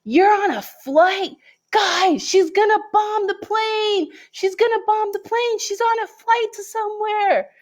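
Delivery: high, mocking kid voice. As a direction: mimic the kids shrieking in mock alarm. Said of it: "And they were like".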